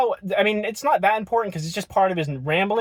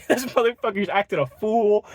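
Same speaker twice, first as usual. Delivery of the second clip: ordinary talk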